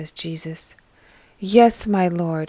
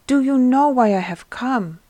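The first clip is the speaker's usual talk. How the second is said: higher, louder eager question; can hardly hold the news in; excited shepherd teasing his news; 5 semitones higher, 4 dB louder than usual. Acted. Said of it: "You are excited".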